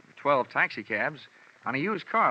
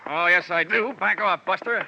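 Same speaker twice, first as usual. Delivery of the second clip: higher, louder shout in a scuffle; fighting shout; 7 semitones higher, 7 dB louder than usual. Acted. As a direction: shove him back and shout him down. Struggle, hero fights off the thug.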